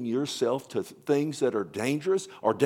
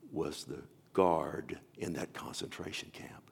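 whisper; 6 semitones lower, 6 dB quieter than usual. Real, not acted. Preacher revealing the camp guard, hushed whisper.

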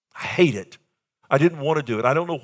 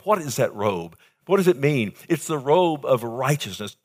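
ordinary delivery

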